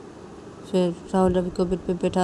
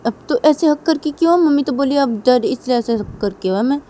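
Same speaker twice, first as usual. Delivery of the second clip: put-on child voice; 7 semitones higher, 7 dB louder than usual. Son questions father about hugging.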